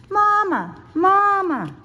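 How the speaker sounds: high, loud repeated call. A narrator's reading. Cub scurries over rocks calling for Mama, high and loud.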